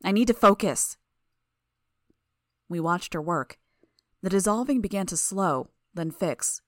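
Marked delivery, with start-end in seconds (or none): 0.0-1.0 snapping. A narrator's reading. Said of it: "Amy snapped".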